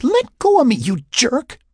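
loud, very high outraged order; high-pitched furious protest; angry command. A narrator's reading. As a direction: demand to be released, outraged. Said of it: "she commanded".

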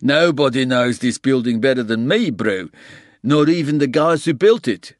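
put-on boastful handyman, thick Afrikaans accent. A narrator's reading. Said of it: "he said in a thick Afrikaans accent".

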